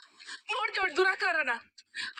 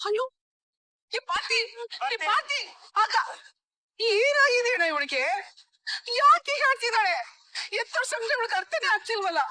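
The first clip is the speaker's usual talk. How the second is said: exasperated outburst; 6 semitones higher than usual. Acted. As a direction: throw up your hands at her. Mother complains loudly to brother.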